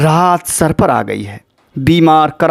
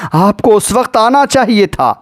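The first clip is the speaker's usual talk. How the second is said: anguished reproach; 6 semitones higher than usual. Acted. Zainab reproaches her father in lament.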